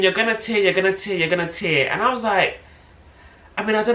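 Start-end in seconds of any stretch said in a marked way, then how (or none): 0.0-1.9 higher, urgent mock yell; rapid-fire repeated warning, half-shouted; retold screaming. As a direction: imitate a midwife screaming a warning. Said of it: "She kept just screaming".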